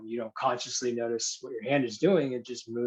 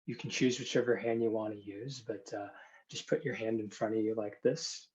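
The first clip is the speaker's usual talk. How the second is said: hypnosis induction; 6 dB quieter than usual. Acted. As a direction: guide them gently into trance.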